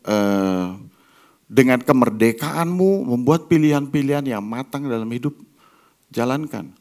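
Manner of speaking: ordinary talk, nothing marked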